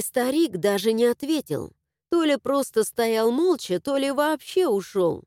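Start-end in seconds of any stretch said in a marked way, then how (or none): none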